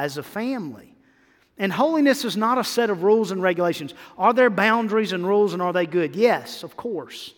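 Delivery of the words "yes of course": ordinary talk, nothing marked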